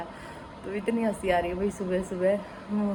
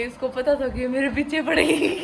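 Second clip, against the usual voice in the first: higher voice breaking into real laughter; laughing; 5 semitones higher than usual. Real, not acted. Wife retelling husband's trick to viewers, laughing. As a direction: lose the last word in a high giggle. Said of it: "मुझे हंसी आ रही है".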